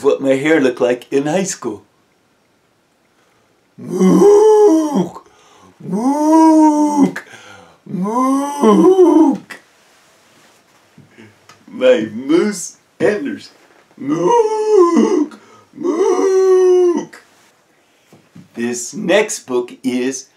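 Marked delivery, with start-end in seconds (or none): 3.8-9.6 high, drawn-out moose hoot; moose call. Pretend. Librarian in moose hat calling out to the kids. 14.0-17.2 high, drawn-out moose hoot; moose call. Pretend. Librarian in moose hat calling out to the kids.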